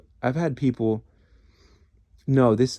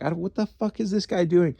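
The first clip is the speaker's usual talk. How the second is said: baffled; 7 semitones higher than usual. Retold or imitated. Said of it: "I'm like".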